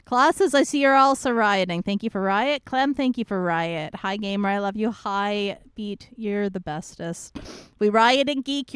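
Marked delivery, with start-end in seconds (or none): none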